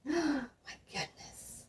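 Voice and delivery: whispered gasp of surprise; hushed my goodness; breathless whisper. Real, not acted. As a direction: breathe it out in shock. Shocked aside.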